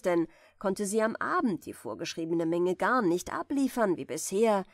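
plain reading voice

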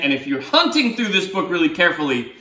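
plain reading voice

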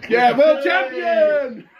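excited cheer. Real, not acted. Cheering contestant on.

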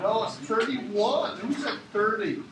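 put-on German accent. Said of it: "This is my German accent coming up".

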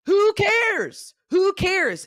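high, loud who cares thrown off; defiant outburst. Real, not acted. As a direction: shout it off, fed up with the fear. Shaking off her own fears.

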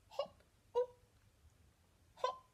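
very high little hops; short high pops, like a frog hopping; squeaky, bouncy hops; hopping sound effect. Acted.

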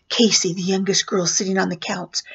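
usual speaking voice

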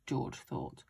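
usual speaking voice

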